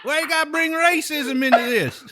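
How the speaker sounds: high put-on Texan voice; hammed-up local taking offence at the stranger; offended Texan local. Texan scolding an outsider over racism, mock offence.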